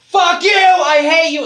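high, drawn-out mock shout; imitated yelling. He imitates people yelling in the session, high mock shout. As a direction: yell it high like someone in group catharsis. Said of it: "People are yelling".

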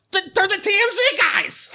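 very high, exaggerated tattling cry; mock accusing. Paparazzo pinning it on TMZ guys, mock accusing. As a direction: pitch way up in fake outrage at the culprits.

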